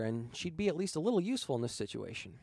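ordinary delivery

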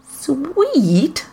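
sharp high disbelieving question; can't believe what he just heard; incredulous. A narrator's reading. Barney in the booth, doubting Robin: incredulous. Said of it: "Barney replied incredulously".

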